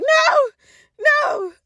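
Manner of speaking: very high, loud panicked no; shrieked no. Retold or imitated. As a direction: shriek it in disbelief. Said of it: "I was like".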